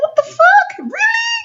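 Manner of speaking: very high squeal of disbelief; shriek-pitched questions, stunned; retold high-pitched incredulity; incredulous. Acted shock on rewinding the fall.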